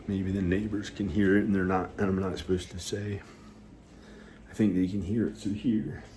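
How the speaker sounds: normal talking voice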